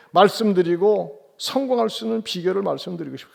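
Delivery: usual speaking voice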